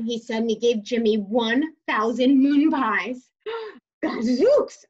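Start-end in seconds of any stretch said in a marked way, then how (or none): 3.5-4.8 gasping surprise. Acted. Jimmy sees a thousand moon pies.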